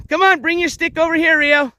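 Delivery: high, encouraging call; upbeat pet-owner coaxing; calling the dog. Real, not acted. Calling dog to bring stick.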